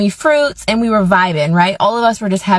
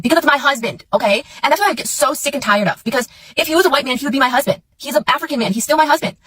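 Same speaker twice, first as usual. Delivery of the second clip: exasperated; 5 semitones higher, much faster than usual. Real, not acted. Frustrated outburst about husband.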